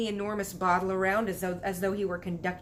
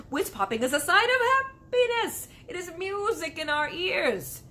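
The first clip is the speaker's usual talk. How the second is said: rapturous delight; 10 semitones higher, 5 dB louder than usual. Acted. Giant rhapsodizing about whiz poppers.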